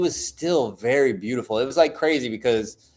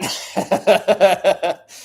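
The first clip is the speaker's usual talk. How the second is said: a higher, louder burst of laughter; real laugh; 7 semitones higher, 4 dB louder than usual. Real, not acted. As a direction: laugh out at the punchline. Laughs at own joke.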